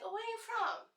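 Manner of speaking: high, airy mock voice of a clueless classmate; mocking college classmate. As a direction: squeak it out like a naive rich kid. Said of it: "they hear the accent".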